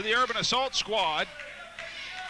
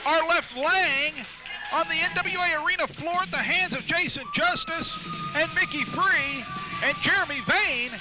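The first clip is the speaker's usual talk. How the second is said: excited commentary; 8 semitones higher than usual. Real, not acted. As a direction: still riding the big finish. After the pinfall.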